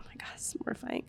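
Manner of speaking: quick, hushed whisper of embarrassment; mortified whisper. Retold or imitated. Mom retelling her embarrassment at her girl's question, mortified whisper. Retold private embarrassed reaction.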